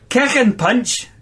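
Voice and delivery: high Scouse-sounding character voice; attempted Scottish that lands in Scouse; Scottish slipping into Scouse. A narrator's reading. Nooch replying to his teacher, accent slipping into Scouse. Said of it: "That went to Scouse then".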